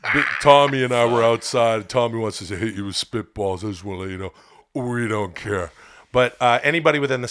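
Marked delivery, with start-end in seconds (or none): 0.0-5.7 low gruff wrestler voice, lightly done; Scott Hall voice. Pretend. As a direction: play it cool like a tough wrestler. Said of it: "I'd dress up as Scott Hall".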